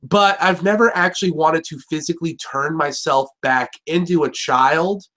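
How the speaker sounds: ordinary talk, nothing marked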